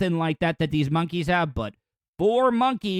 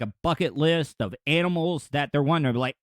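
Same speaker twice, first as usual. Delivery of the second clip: normal talking voice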